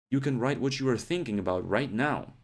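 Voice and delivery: ordinary talk, nothing marked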